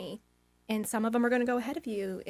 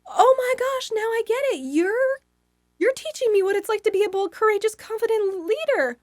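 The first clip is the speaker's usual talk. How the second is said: high, loud eureka moment; retold revelation; 12 semitones higher, 10 dB louder than usual. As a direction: burst out with sudden understanding.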